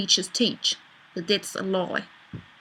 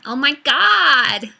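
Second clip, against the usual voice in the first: gushing Californian "Oh my God", slow and much louder; Californian accent; 5 semitones higher, 10 dB louder, much slower than usual. Pretend. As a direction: stretch it out like an over-excited Californian. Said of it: "what about a Californian accent?".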